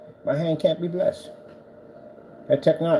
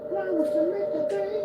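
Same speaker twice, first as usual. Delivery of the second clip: much higher, squeaky singsong repeat; high silly voice; 4 dB quieter than usual. Pretend. Repeating the line to the cat, high silly voice. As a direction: parrot the line in a cartoon squeak. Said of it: "repeats it in put-on voice".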